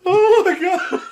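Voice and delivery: high, loud words through real laughter; helpless laughter. Real, not acted. Listener reacting to the punchline, helpless laughter. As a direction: gasp it out while cracking up. Cracking up at the comic.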